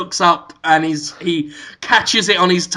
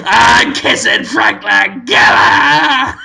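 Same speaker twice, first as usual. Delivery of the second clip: mock shout to camera; 4 semitones higher, 5 dB louder, slower than usual. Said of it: "he says at the camera".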